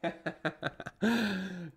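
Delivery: staccato chuckle running into a longer laugh; laughter. Real, not acted. Streamer amused by his own silliness, laughing. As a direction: laugh in quick bursts, then let it stretch out.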